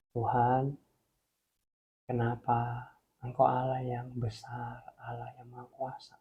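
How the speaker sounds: soft, hushed, mildly tearful plea; retold tearful prayer. Repentant man asking God why, softly tearful. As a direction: act a broken prayer quietly, with pauses. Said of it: "Dia nangis".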